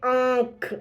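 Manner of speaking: आंख drawn out slowly with the vowel through the nose; teacher stretches आंख to let students feel the nasal; nasal demo word. Shown as a demonstration. Teacher slowly shows students the nasal आंख. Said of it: "मुंह और नाक दोनों से हवा साथ निकलती है".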